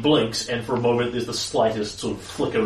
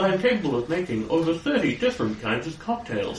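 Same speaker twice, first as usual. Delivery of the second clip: high, proud robot butler; boastful machine servant voice; 5 semitones higher than usual. Acted. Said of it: "more of a robot voice".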